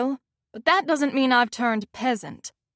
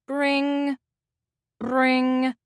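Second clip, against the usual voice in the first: phone ring; 2 semitones higher than usual. A narrator's reading. Phone ringing wakes her.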